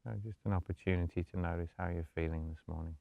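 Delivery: soft, low-key meditation voice with long pauses; guided meditation. Acted. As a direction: gently guide them into stillness, hushed and unhurried.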